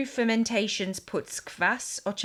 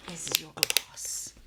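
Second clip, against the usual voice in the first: whispering; 4 semitones lower, 6 dB quieter, slower than usual. Real, not acted. Handing over a glass quietly.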